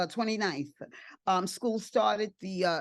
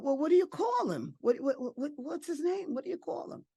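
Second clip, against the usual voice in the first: eager questioning; 7 semitones higher, faster than usual. Retold or imitated. Retells anxiously asking baby's name.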